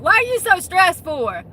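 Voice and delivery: loud, high mock confrontation; high-pitched, pushy mocking question; in-your-face mocking. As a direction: imitate someone getting right in your face. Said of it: "get in your face and be like".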